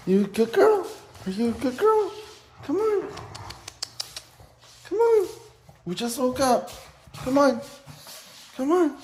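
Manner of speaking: very high excited puppy talk; talking to a dog. Real, not acted. Early morning coaxing the puppy.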